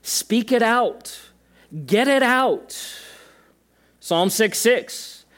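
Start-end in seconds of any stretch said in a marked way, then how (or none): 0.0-2.8 high, slow and emphatic; slow urging command. Retold or imitated. God urging people to speak their trouble out, slow and high. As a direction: urge them gently to let it out. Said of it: "God's like".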